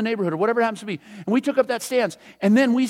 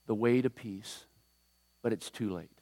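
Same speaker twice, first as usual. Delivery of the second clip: Jesus grieving over the city, much softer and lower; sorrowful; 9 semitones lower, 9 dB quieter than usual. Acted. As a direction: let the words die away in grief. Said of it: "he began to weep".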